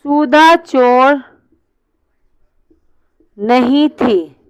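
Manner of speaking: धीरे, बीच में ठहराव के साथ; सुधा चोर... नहीं थी, लिखवाओ; slow dictation. Shown as a demonstration. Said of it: "चलो". (क्लास को नया वाक्य धीरे से लिखवाता टीचर)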